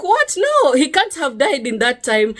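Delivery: a high, sharp "What?" of disbelief; shocked disbelief. Retold or imitated. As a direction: cry out in shocked denial. Told husband is gone.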